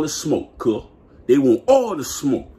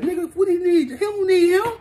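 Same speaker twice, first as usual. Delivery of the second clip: scoffing retort; 13 semitones higher than usual. Retold or imitated. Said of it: "I'm like".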